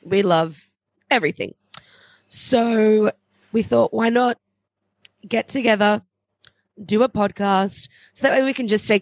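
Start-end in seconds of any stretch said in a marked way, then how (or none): none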